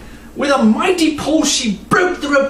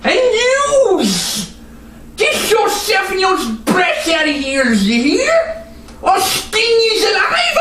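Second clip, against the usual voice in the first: snarled threat; 7 semitones higher, slower than usual. Acted. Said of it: "turned towards Madeline and snarled".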